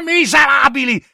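high, furious outburst; furious cry. Acted. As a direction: spit the insult at them. Insulta gli ufficiali. (insulto furioso, acuto)

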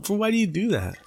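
lightly voiced dad scolding a friend; imitating dad. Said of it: "My dad would tell him".

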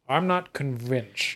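slow, soft skeptical line; slow unconvinced. Acted. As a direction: drag it out, doubting. GM voices Crash doubting.

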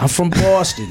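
amateur Boston accent. Shown as a demonstration. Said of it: "how do you do a Boston accent".

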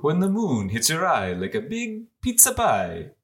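exaggerated Italian crooner accent. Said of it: "who has the best Italian accent".

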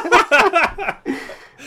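loud burst of real laughter; hearty laugh. Real, not acted. Laughing at co-host's slip.

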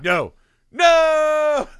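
high, loud mock protest; hammed-up shout; mock cry. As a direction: yelp no, no in fake panic. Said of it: "I was like".

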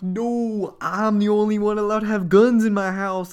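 high, loud hammed-up objection; mock outraged protest. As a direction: object with comic indignation. Dad protests at the gun.

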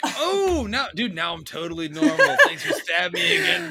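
high, loud mock-grateful exclamation, half laughing; mock relief. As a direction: gush thanks in a high, amused voice. Mock-grateful stab victim in a joke.

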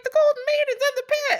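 very high rooster voice; rooster tattling. Acted. Rooster revealing the maiden to the servants, high squawk. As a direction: squawk the secret out.

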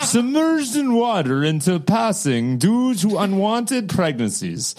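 bad Russian accent, breaking into laughs; mock Russian accent. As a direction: keep the hammy Russian accent going. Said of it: "I can't do a Russian accent".

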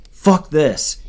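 high, loud exclamation; angry yell in a story; shouted. A narrator's reading. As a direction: shout it out, fed up. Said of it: "He shouted".

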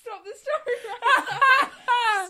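loud, high, drawn-out protest through laughter; laughing protest. Real, not acted. Co-host begging the storyteller to stop, laughing loudly. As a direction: shout it while cracking up.

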